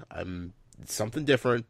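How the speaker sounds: ordinary delivery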